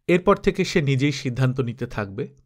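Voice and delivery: usual speaking voice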